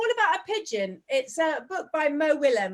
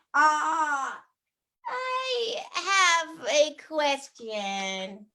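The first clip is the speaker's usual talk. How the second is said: put-on crazy American accent; slower than usual. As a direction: read the pigeon story in an over-the-top American accent. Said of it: "I used to do it with a very crazy American accent".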